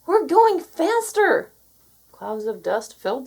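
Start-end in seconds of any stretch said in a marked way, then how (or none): 0.1-1.5 alarmed. A narrator's reading.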